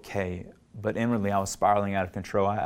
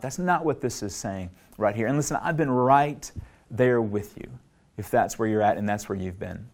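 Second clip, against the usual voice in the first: ordinary talk, nothing marked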